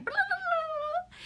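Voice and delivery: very high, slow blah-blah chatter; mock chatter. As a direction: squeak out the chatter like an overeager morning person. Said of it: "and I was like".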